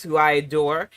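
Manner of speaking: ordinary talk, nothing marked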